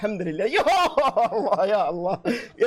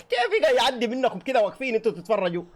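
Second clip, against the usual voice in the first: ordinary delivery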